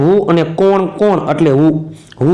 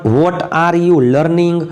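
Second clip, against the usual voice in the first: slow dictation; much slower than usual. Shown as a demonstration. Teacher models English sentence slowly.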